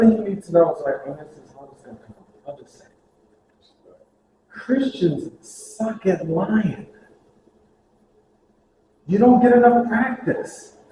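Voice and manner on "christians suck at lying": "Christians suck at lying" drawn out slowly, weight on "Christians"; deadpan slow verdict; slow emphatic punchline. Real, not acted. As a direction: land it word by word. Punchline delivered deliberately.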